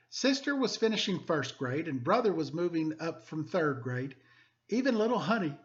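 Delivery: lively, expressive picture-book reading; storybook narration for students; reading to children. Acted. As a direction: read it like story time for the class. Teacher reads picture book aloud.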